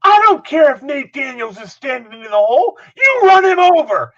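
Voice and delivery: high, forceful shout; Dick Adams. Retold or imitated. Coach overriding the running back's excuse, furious high bellow. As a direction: bellow it, building to the final order. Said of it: "you hear Coach Adams say".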